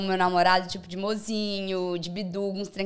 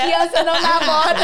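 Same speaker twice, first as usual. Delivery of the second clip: higher, louder mock kid voice; echoed child voice; 6 semitones higher, 8 dB louder than usual. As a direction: echo the little kid playfully, pitched up. Echoes the child voice amid laughter. (imitando criancinha, mais agudo e alto)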